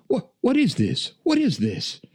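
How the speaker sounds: high-pitched imitation of astonishment; mock bewilderment. Imitating friend at his first drive-thru, astonished. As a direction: imitate a baffled friend, voice shooting up.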